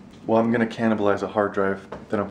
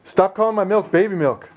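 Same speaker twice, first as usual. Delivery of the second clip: mock whine; 8 semitones higher, 3 dB louder than usual. Mock quarrel with wife.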